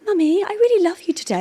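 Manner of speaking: lightly voiced high sugary little boy; sweet child voice. Mum lightly imitating her son's sugary show-off line. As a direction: play the angelic child showing off. Son Alfie quoted being sweet.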